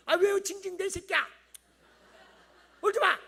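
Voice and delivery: high, loud irritated scolding; annoyed mother scolding. Acted. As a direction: snap at the whining child. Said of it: "엄마가. 욕하잖아". (짜증 내며 아이를 꾸짖는 엄마)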